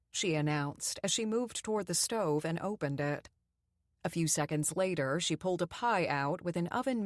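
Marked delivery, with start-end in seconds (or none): none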